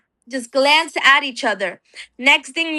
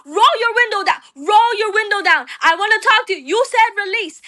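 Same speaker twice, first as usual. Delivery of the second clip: demanding yell; 6 semitones higher than usual. Retold or imitated. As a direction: order them to roll it down. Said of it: "And I say".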